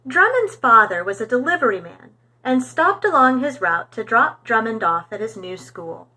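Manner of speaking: ordinary delivery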